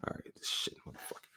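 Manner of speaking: hushed unvoiced curse under his breath; startled whisper. Real, not acted. Spooked host whispering a curse at the ghost. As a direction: whisper it so the ghost won't hear.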